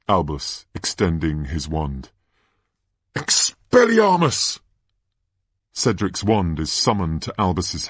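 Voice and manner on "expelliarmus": high, drawn-out incantation; cried-out spell. Acted. Boy extending his wand to cast, crying out the spell. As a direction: call it out with force.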